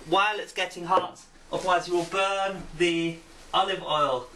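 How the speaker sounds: ordinary delivery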